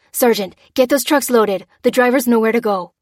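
loud commanding instructions; barked orders. Acted. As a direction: order him to load up. Lucy gives orders to sergeant.

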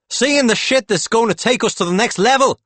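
very high, loud, fervent shout; excited climax. A narrator's reading. Howie promising Claude the next level, shouting with excitement. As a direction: burst out with wild conviction.

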